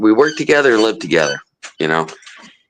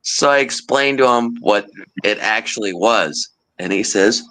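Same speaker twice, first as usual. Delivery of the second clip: ordinary talk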